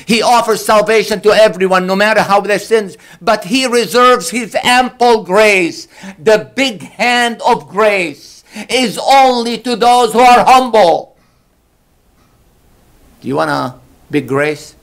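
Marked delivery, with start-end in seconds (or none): 0.0-11.2 impassioned preaching. Acted. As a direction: build the sermon to a ringing peak. Preacher rising to full force.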